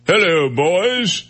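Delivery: slow, high Liverpool greeting with a hint of throat. Shown as a demonstration. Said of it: "didn't he talk like this".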